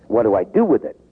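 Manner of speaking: ordinary talk, nothing marked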